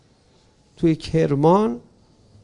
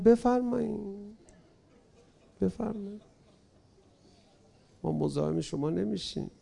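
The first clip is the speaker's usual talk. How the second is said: quiet dismissal; 2 semitones higher, 9 dB quieter than usual. Acted. Imam softly lets them go.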